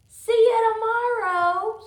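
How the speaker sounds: very loud, high and slow, menacing; really loud. Acted. Wendell taunting Sophie at bedtime, shouted in all caps. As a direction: blast it out like the caps demand. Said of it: "when we see all caps ... say that really loud".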